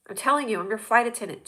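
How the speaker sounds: normal talking voice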